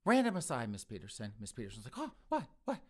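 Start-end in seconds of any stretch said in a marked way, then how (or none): 2.0-2.8 high, startled little burst of questions; mock-flustered, pitched up high; startled retort. Woman caught off guard by a guest, startled.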